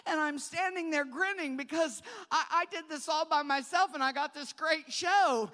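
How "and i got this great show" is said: normal talking voice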